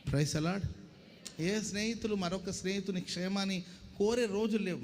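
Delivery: ordinary talk, nothing marked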